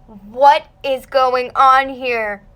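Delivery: loud, drawn-out mock bewilderment; slow, loud baffled question; utterly confused. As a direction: stretch each word in disbelief. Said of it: "I was confused out of my mind".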